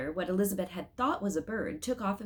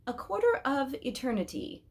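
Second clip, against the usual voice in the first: thoughtful start; 7 semitones higher than usual. A narrator's reading. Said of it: "he began".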